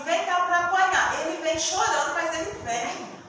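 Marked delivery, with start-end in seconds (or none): 0.0-1.2 higher, louder mock call to a child; mock stern call. Parent calling a child to be spanked, mock stern. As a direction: call the kid over sternly. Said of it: "falar assim".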